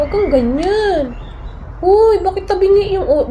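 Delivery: put-on high, babyish coaxing; cooing at a toy. Vlogger coaxing an angry-looking toy, high baby talk. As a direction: coo at it like a sulky pet.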